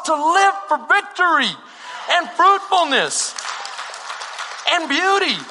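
high, sustained, forceful sermon delivery; impassioned preaching. Real, not acted. Preacher urging believers never to return to the curse, fervent exhortation. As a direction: drive every phrase home to the congregation, pitched high.

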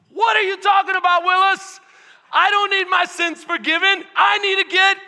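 high, shouted protest; hammed-up loud objection to Jesus; indignant paralyzed man. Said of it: "How many of you would be like".

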